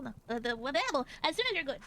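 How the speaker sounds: ordinary talk, nothing marked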